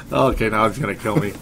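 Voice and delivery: ordinary talk, nothing marked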